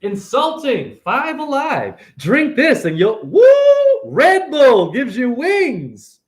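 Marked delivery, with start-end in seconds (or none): 1.0-6.2 high, drawn-out parody of a commercial; mock ad voice. Mocking energy drink ads to his audience, hammed-up ad voice. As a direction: ham up the drink slogan like an announcer.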